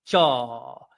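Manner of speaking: elongated "jaw", held and falling; drawn-out demonstration. Shown as a demonstration. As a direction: draw out the vowel in JAW. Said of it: "allow that word to elongate".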